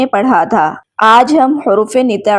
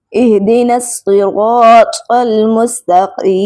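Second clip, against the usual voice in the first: slow, drawn-out tajweed recitation of each word; Quran recitation; much slower than usual. Shown as a demonstration. Teacher reciting Quran examples for students, slow recitation. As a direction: chant the verse slowly as in tilawat.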